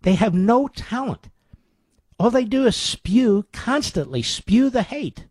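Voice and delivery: rising exasperated harangue; outraged rant. Real, not acted. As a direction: work yourself up in disgust.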